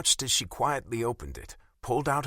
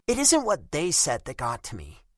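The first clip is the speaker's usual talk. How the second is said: put-on higher, hurt young woman's voice; a wounded girlfriend, raised in pitch; Bella's voice; 6 semitones higher, 3 dB louder than usual. A narrator's reading.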